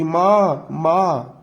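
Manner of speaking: mild frightened calling for mother; a child's scared cry of mother, understated. A narrator's reading. Daughter sees her mother dragged away, mildly frightened. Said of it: "डर के कारण बोलती".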